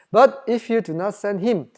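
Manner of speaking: slow, measured Bible reading; scripture reading. Acted. Preacher reading the verse to the congregation, slow and measured. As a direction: read each English word out slowly. Reads scripture aloud slowly.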